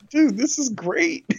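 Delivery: high mock excitement. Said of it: "I'm like".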